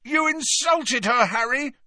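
very high, indignant shout. A narrator's reading. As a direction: yell the accusation. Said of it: "shouted Ron".

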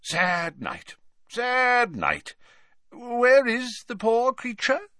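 high, theatrical sorrowful wizard; Slughorn theatrical. Acted. Slughorn laments to Hagrid, high and theatrical. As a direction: ham up the mourning.

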